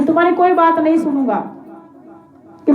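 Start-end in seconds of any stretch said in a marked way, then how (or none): 0.0-1.4 quick, high acted refusal; brusque royal dismissal, pitched up; firm refusal. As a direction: cut off a pleader. King refuses to listen to Kalyug.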